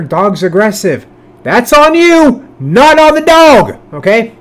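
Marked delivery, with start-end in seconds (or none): 1.4-3.8 high, loud, slow emphasis on each word; lecturing emphasis. Real, not acted. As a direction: drive the lesson home slowly. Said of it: "life lesson for y'all".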